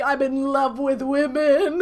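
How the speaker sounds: loud slow proclaiming of love; loud, slow lovestruck yell; screaming declaration. Retold or imitated. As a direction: belt it out to the whole building. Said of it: "screaming this outside".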